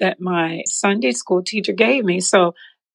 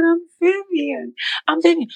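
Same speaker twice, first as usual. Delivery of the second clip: excited; 10 semitones higher than usual. Retold or imitated. Answering pastor, overjoyed.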